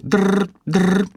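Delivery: high, loud trilled ringing; loud phone ring imitation.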